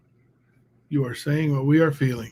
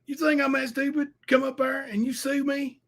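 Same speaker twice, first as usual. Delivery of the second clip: a higher mock rival voice; mock whiny rival; 10 semitones higher than usual. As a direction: lightly whine it like the coward. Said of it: "what he said was".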